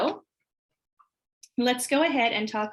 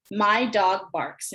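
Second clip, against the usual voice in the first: each word spaced out slowly; slow word-by-word sentence; much slower than usual. Shown as a demonstration. Teacher modeling a sentence for students, slow and spaced. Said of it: "So I'm going to start with a sentence".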